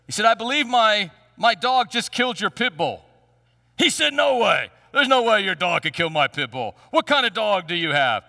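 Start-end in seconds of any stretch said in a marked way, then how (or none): 0.2-3.0 higher, louder nervous blurt; mock-nervous little man confessing. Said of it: "obviously he was very nervous". 4.1-8.2 incredulous biker. Retold or imitated. Biker disbelieves the little man.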